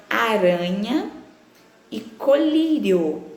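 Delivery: "Aranha" and "colírio" drawn out slowly on RAN and LÍ; tônica na penúltima. Shown as a demonstration. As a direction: stretch the next-to-last syllable of "Aranha" and "colírio". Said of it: "a sílaba tônica é a penúltima". ("Aranha" e "colírio" devagar, alongando RA e LÍ)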